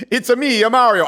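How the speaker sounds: loud, high hammed-up Italian Mario yell; Mario Italian accent. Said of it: "scream in an Italian accent".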